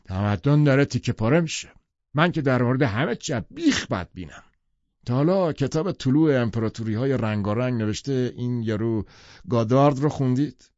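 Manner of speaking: Tom, forceful and loud, sure of himself; firm. A narrator's reading. Tom lecturing the dinner guests, forceful. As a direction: lay it down hard, no room for argument. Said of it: "تام خیلی محکم گفت".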